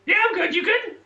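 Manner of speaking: high chirpy bird voice; big beaked toucan. Acted. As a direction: talk like a squeaky toucan. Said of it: "my toucan impression".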